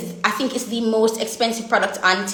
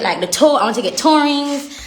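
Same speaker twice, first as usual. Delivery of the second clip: ordinary talk, nothing marked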